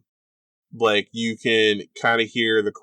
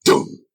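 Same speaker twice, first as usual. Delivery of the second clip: louder, punched mock boom; imitated stage boom; 6 dB louder than usual. Game master mimics the entrance effect on one side, booming. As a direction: voice the second heavy thud. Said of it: "it's just like".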